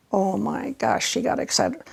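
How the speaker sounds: ordinary talk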